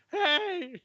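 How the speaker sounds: very high, squeaky fairy call; Mopish as fairy. Acted. Co-host teasing the host's fairy attempt, high mock fairy voice. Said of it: "imagine Mopish being the fairy".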